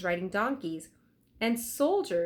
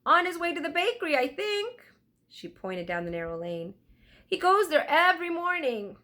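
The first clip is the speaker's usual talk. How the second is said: put-on much higher, louder old woman's voice; a chatty old weaver woman, eager to help; toothless old woman; 9 semitones higher, 4 dB louder than usual.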